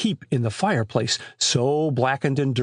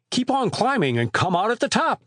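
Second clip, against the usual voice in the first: high-pitched call up the chimney; 9 semitones higher than usual. A narrator's reading. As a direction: call up to someone above, pitch well raised. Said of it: "called Chet".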